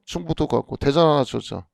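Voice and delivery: usual speaking voice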